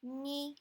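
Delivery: high, level "ni"; flat, sustained high tone on "ni"; first tone. Shown as a demonstration. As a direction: hold "ni" on one high pitch. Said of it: "one, two, three".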